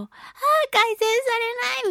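high, whiny mock lament; hammed-up helpless whine; mock despair. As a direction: wail it in pretend misery. Acts out frustration at failed gargling.

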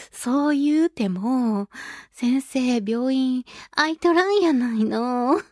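slow, drawn-out fake Kansai lilt; dodgy Kansai dialect. Patient complaining that the dentist is closed, dodgy Kansai dialect. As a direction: put on a hammy Kansai woman's drawl. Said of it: "怪しい関西弁使ってきた".

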